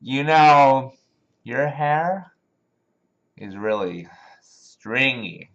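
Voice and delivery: put-on slow, loud slurred drunk; boozy drawn-out pickup lines, words lurching out. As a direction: imitate a drunk clumsily chatting someone up. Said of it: "try to pick up girls when they're drunk".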